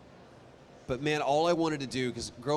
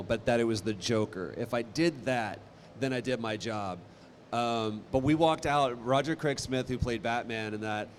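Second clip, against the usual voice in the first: ordinary delivery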